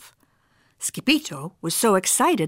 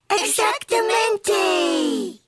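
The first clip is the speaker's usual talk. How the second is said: high, long-drawn doggy howl; howling cheer; 13 semitones higher, much slower than usual. A narrator's reading. Doggies answering their friend, howled out together. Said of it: "howled the doggies".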